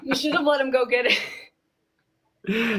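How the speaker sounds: slow words through laughter; laughing. Real, not acted. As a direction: say it while still laughing. Laughing reply.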